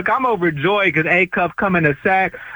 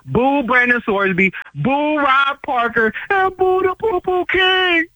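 high, drawn-out booing call; playful heckle held on a high note; mock jeer; 3 semitones higher, slower than usual. Caller ends trash talk with boos.

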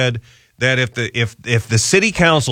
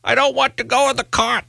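put-on Holy Grail old man; 11 semitones higher than usual. Host imitating the Holy Grail old man who won't go in the cart. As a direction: squeak it out like a frail old man who refuses to die. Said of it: "became the old man in Holy Grail".

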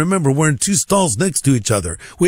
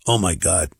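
low, softer retold reaction; stunned dismay; 9 semitones lower, 5 dB quieter than usual. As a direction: let it sink out quietly, as if caught. Caught drug buyer meets his pastor.

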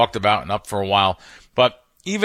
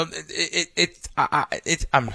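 halting, repeating false starts; stuttering; 5 semitones higher, 3 dB quieter than usual. Real, not acted. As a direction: stumble over the words. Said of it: "I'm stuttering here".

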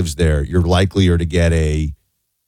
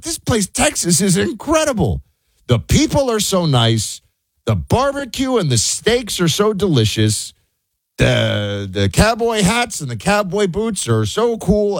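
put-on higher, wide-eyed tourist raving without accent; gushing tourist; 12 semitones higher than usual. Host imitating tourists praising Texas, higher gushing voice. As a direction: rave like a thrilled first-time visitor. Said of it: "I won't do their accents".

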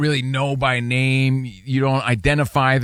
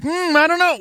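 doubtful reaction. Retold or imitated. Co-host recalling his reaction to the claim, high sceptical musing. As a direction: replay your own scepticism up high. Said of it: "And I was like".

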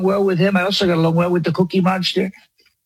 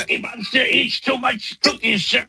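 quoting Bobby; 7 semitones higher than usual. Retold or imitated. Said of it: "Bobby said to me".

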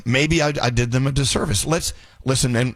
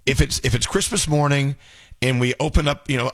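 ordinary talk, nothing marked

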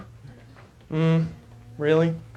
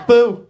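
spooky boo; 8 semitones higher than usual. Acted. Halloween laugh and boo.

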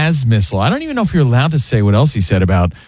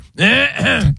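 clearing throat; 9 semitones higher than usual. Real, not acted. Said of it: "Sorry, choking on a splinter".

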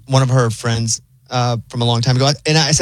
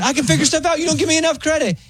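gloating; 14 semitones higher than usual. Retold or imitated. Retold gloating to wife.

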